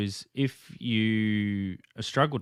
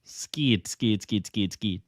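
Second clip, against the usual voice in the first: New Zealand accent; much slower than usual. Shown as a demonstration. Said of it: "Say scared six times".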